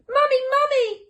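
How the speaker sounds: put-on high, loud child calling for mum; frightened cry. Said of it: "they cried out".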